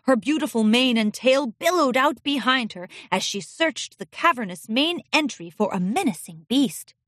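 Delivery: ordinary delivery, nothing marked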